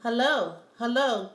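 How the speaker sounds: lightly voiced African accent; a hint of an African accent, falling hellos; greeting in a mild African accent. A narrator's reading. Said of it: "with his proper African accent".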